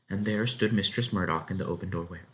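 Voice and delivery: plain reading voice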